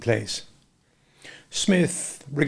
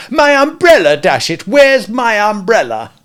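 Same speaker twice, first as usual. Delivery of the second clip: high, loud exasperated shout; exasperated outburst; 10 semitones higher, 9 dB louder than usual. A narrator's reading. Walderwick exasperated at Smith, shouting. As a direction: lose patience and bellow for it.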